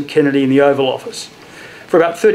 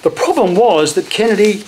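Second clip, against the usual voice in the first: ordinary talk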